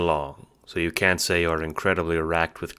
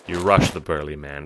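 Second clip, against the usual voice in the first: usual speaking voice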